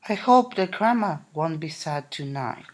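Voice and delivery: usual speaking voice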